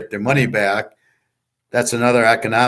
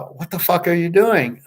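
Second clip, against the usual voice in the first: higher, disbelieving what the fuck; incredulous outburst; 6 semitones higher than usual. Retold or imitated. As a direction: throw it at him in disbelief. Retold outburst at the CFO.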